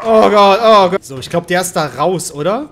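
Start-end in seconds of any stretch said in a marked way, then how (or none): 0.0-1.0 loud, raised cries of alarm; real fright. Real, not acted. Player scared mid-game, loud alarm. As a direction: blurt it out in sudden panic.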